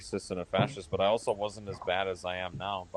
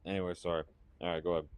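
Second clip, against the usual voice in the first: ordinary delivery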